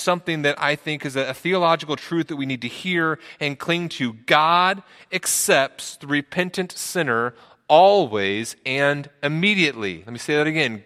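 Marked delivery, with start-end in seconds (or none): 4.1-10.0 slow, weighty stress on "always" and "immediately"; emphatic declaration. Real, not acted. Preacher giving the church a truth to cling to, emphatic. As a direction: spell out "God accepts the repentant sinner" slowly, word by word.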